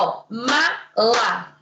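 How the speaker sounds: mala stretched slowly, ma then la; drawn-out syllables. Shown as a demonstration. Teacher sounding out mala slowly to a child.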